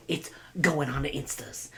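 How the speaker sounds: breathless whisper of a secret. Retold or imitated. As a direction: whisper it to the followers. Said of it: "I'm like".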